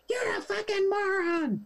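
mock Karen screech; mock shouted insult. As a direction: squeal the insult in his face. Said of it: "shouted into my face".

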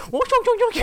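very high mock Chinese babble. Joking that emails read the same, mock Chinese babble. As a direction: imitate Chinese speech in a squeaky-high voice. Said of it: "Pakai bahasa Cina".

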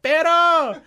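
high mock call across the shop; coworkers calling out. Shop workers teasing him with the nickname, high mock call. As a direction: belt out the nickname like a whole crew. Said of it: "everybody ... was like".

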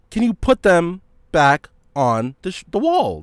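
loud, high-pitched pointed request; exasperated retelling. Retold or imitated. Clerk to shopper about the shoes, exasperated. Retold argument over shoes on floor.

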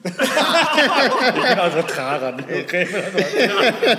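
loud, slow laughing boast. Real, not acted. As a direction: laugh it out proudly. Proud joke about hometown. (lachend und laut)

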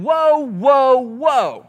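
high, loud startled whoa; alarmed whoa. Retold or imitated. Couple waiting a year, suddenly alarmed. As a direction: pull up short in alarm.

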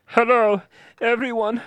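put-on a young man's shaky farewell video, held together; grieving streamer. Jason tells his followers Liam is dead, tearful. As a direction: keep it together through the tears.

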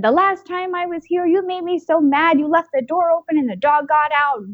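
imitating her little daughter. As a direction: pitch it up like a scolding small child.

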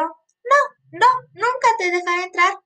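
high little pig voice; high piglet voice. A narrator's reading. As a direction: squeak it out like a piglet. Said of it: "La cerdita respondió".

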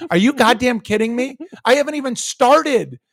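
irate caller losing his temper; retold furious yelling. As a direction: yell at the agent who threatens to hang up. Retold shouting match with agent.

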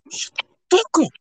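high, loud mock outburst; sudden high yelled retort; retold yell.

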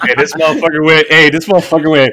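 shouted high through laughter; cracking up while telling it; loud laughing call-out. Real, not acted.

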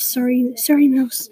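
ordinary delivery

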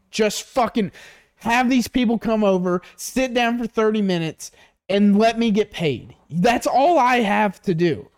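high, insistent begging rant; exasperated pleading. Acted. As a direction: plead desperately with a stubborn customer. Acted plea to reluctant customer.

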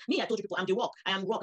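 ordinary delivery

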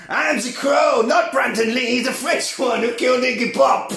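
high, loud mock-French vampire; Marius. Marius introduces himself to Lestat, loud high French voice. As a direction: proclaim it in a hammed-up French accent. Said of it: "A French voice".

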